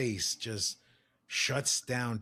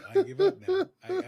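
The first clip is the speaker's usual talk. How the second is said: laughing despair; 6 dB louder than usual. Real, not acted. Laughing at his own despair.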